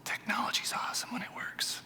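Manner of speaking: very soft, voiceless whisper; whispered aside. Real, not acted. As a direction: whisper a wry aside while the tech stalls. Wry aside while slides are stuck.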